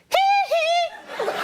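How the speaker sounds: very high falsetto giggle-yelp; piercing "hee, hee". Retold or imitated. Star enjoying a movie, high falsetto squeal. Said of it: "He's the loudest person in there".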